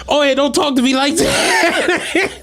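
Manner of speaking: put-on slow, high sassy kid voice; mocking the kid. Driver mimicking the kid's sassy comeback, slow and high. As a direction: drag it out like a cheeky teen. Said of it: "He like".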